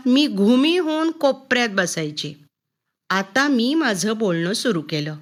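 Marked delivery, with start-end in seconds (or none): none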